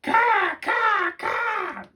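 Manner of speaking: high, loud cawing cackle; laughing raven caw. A narrator's reading. Raven laughing at the foolish humans, loud high caws. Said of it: "laughed the third raven".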